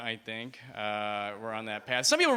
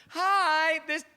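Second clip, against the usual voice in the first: voicemail greeting; 10 semitones higher than usual. Retold or imitated.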